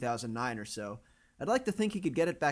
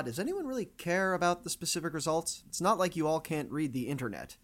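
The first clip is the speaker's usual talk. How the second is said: usual speaking voice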